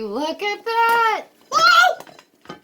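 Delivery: high, loud, slow amazed cry; shocked laughter. Real, not acted. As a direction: shriek with real delight. Waffle comes out whole.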